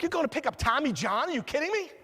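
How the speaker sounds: high-pitched retold disbelief; incredulous. Golfer to attorney about picking up Tommy John, incredulous. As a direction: react in astonishment, pitch climbing sharply at the end.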